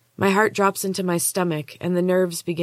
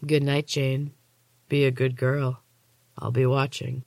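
menacing orderly; 3 semitones lower than usual. A narrator's reading. Orderly leaving with a threat.

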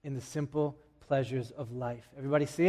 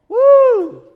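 high, loud delighted whoop; excited whoop; 19 dB louder than usual. Retold or imitated. Preacher playing teen girls at the mall, joyful whoop. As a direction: let out a thrilled shopping cheer.

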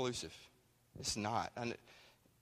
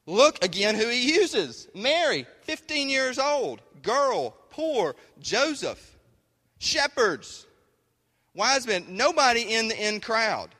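much higher and louder, slow at first; full-force preaching; 9 semitones higher, 7 dB louder, slower than usual. Acted. Preacher naming the humble people God uses, at full force. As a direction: proclaim each name like a revelation.

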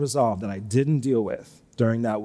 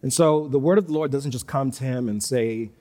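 ordinary delivery

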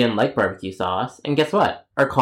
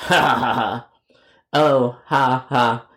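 sarcastic laugh; slower than usual. Acted.

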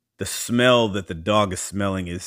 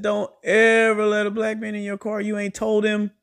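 much higher, squealing mock-lecture; exasperated scolding; 14 semitones higher, 4 dB louder than usual. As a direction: shriek the advice like an outraged auntie. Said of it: "I said".

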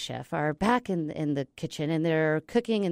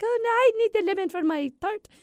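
very high, hammed-up pastry chef; high-pitched comic chef, outraged; arguing pastry chef; 4 dB louder than usual. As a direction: squeal in mock French protest. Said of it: "the pastry chef's like".

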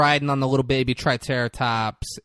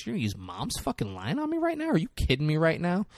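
higher, rushed mock disbelief; incredulous sister; 4 semitones higher, 5 dB quieter, faster than usual. Sister to sister over Mom's line, mock incredulous. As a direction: fire it back in disbelief.

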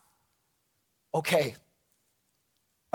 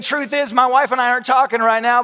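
ordinary delivery

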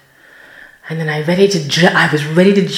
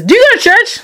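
high, louder sweet questioner; bright woman; 5 dB louder, much faster than usual. Retold or imitated. The woman in pink asking at the door, high and bright. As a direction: ask it high and eager. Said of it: "she says".

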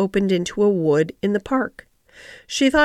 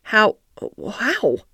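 mildly urgent, eager question; eager cry. Acted. Mary presses the gardener, forgetting caution, with an eager cry. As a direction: ask it twice, as if forgetting to be careful. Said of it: "cried Mary, forgetting to be careful".

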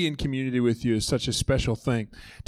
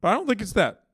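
ordinary delivery

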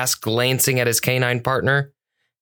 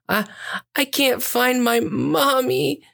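put-on high, slow, halting little-girl voice; acted sobbing child; 11 semitones higher than usual. A narrator's reading. Lost girl to the cat officer, acted high sobbing. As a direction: play a tearful child, pitched way up. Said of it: "she sobbed".

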